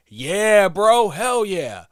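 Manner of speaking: loud, high, slow hell yeah; egging on the cashier, loud and high; hyped agreement. Retold or imitated. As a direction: cheer him on, dragging it out. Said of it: "And I'm like".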